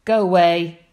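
loud grumpy shout. Acted. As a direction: bark it out, cross. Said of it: "shouted the bad-tempered ladybird".

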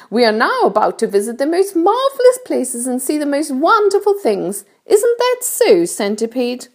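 high-pitched storybook ladybird voice; kindly ladybird. A narrator's reading. Ladybird explains things to James, kindly high voice. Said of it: "said the ladybird".

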